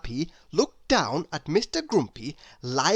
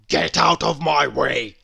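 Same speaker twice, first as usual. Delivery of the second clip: louder gruff character snap; snapping; 3 semitones lower, 5 dB louder than usual. Acted. Mister Grumpy snapping at Mister Happy. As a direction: snap it like a cross old grump. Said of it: "snapped Mister Grumpy".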